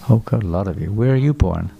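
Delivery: normal talking voice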